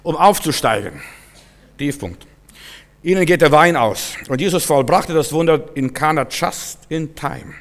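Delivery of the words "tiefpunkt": "Tiefpunkt" repeated much softer, falling; softly echoed "Tiefpunkt"; quiet echo. Shown as a demonstration. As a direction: drop "Tiefpunkt" to a hush. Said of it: "diesen Tiefpunkt".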